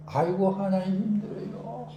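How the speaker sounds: sighing, worn-out groan of a prayer; weary lament. Retold or imitated. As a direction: moan it like someone at the end of his strength. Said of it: "다윗이 그렇게 기도했다는 거예요". (지친 탄식)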